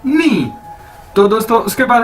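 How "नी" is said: नी pitched higher, falling off; नी modelled high, then dropping; Chinese nǐ. Shown as a demonstration. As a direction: repeat नी higher for emphasis. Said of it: "कैसे".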